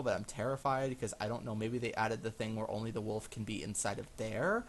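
usual speaking voice

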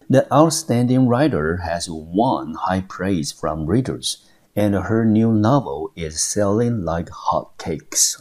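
slow, careful English reading; example sentence read aloud. Shown as a demonstration. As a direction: read it slowly like a model sentence.

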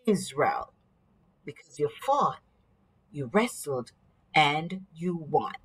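bestow the new name gravely; solemn blessing. Acted. Stranger blesses Jacob solemnly.

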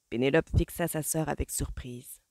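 usual speaking voice

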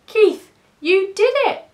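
high, loud delighted congratulation; cheering. A narrator's reading. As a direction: cheer for the hero. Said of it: "the other cats cheered".

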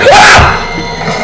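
very high, loud gasping scream; sudden shrieked gasp of fright; startled shriek. Real, not acted. Player hit by a jump scare, startled shriek.

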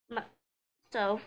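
usual speaking voice

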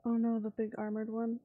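ordinary talk

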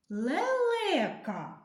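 лелека said very slowly, every syllable stretched; drawn-out dictation. Shown as a demonstration. Teacher dictating a word to first graders, stretched slowly. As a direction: stretch лелека out for the children to write. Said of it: "зробіть звуковий аналіз цього слова".

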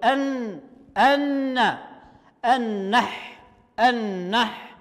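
أن then النح with the lam dropped straight into a doubled noon; النح said with no lam heard, noon held; skip the lam. Shown as a demonstration. Teacher showing pupils how to read النحلة, lam silent. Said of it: "نترك اللا إلى النون على طول".

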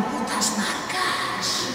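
slow, sinister whisper "Putas marcas"; evil supermarket boss plotting; villain whisper. Pretend. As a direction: hiss it like a cartoon villain in his castle.

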